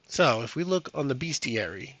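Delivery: usual speaking voice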